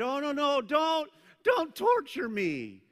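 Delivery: loud, high hammed-up begging; mock pleading. Preacher mimicking the possessed man begging Jesus, mock pleading. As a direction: plead in exaggerated alarm, drawing out the end.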